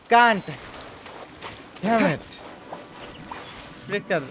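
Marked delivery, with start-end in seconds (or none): none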